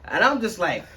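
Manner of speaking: high, loud laughter through the words; real laughing while telling the story. Real, not acted. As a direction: crack up mid-story. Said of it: "laughing while telling".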